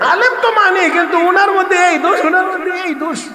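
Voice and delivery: critics imitated in a very high voice; mock complaint. Congregant claims to respect clerics but objects, high-pitched mock complaint. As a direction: pitch the objection way up, mimicking them.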